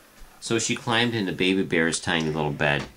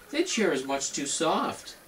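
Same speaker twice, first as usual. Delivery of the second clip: higher, lighter mother bear voice; Mama Bear voice; 9 semitones higher than usual. Acted.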